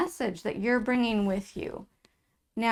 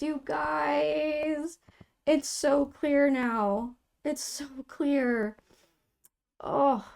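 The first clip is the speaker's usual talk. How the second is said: higher, slow, moved and awed; choked-up wonder at the cards; overcome with emotion; 5 semitones higher, much slower than usual. Real, not acted. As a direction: let the realization well up slowly. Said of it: "Oh, I'm getting emotional".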